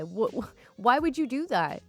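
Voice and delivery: usual speaking voice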